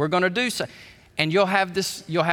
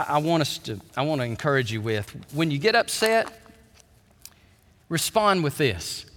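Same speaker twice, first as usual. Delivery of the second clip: usual speaking voice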